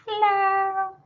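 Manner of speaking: high, soft little-creature hello; tiny moth voice. Pretend. Showing viewers the moth on her cup, high soft moth hello. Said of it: "He's saying".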